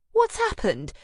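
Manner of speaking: high, loud startled exclamation; exclaiming. Acted. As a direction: gasp it out in alarm. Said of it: "she exclaims".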